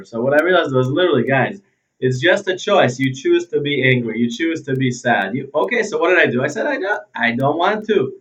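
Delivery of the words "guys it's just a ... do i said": normal talking voice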